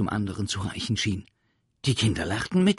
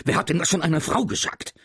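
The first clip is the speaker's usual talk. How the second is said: annoyed teacher; 10 semitones higher, 7 dB louder, faster than usual. Acted.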